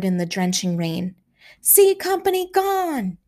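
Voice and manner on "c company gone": much higher, yelled report; frantic shout. A narrator's reading. Signal station shouting losses in the pandemonium, frantic yell. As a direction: cry out the loss in panic.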